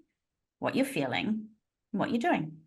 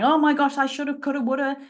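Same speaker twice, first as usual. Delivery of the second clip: frantic self-talk she no longer does; mock frantic self-talk; 6 semitones higher, faster than usual. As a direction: ham up the old frantic self-blame.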